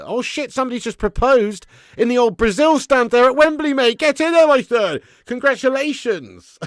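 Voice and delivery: loud, high shout of celebration; excited cheering. Real, not acted. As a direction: burst out cheering like a fan at the match. Reacts live to a stadium proposal.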